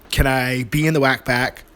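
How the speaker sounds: normal talking voice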